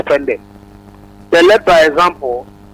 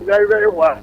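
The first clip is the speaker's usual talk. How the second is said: usual speaking voice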